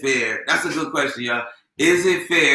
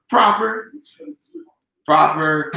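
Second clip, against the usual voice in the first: L.A. accent with drawn-out syllables; Southern-tinged L.A. accent; slower than usual. Shown as a demonstration. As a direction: say it proper, L.A. style. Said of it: "sounds a little bit kinda Southern".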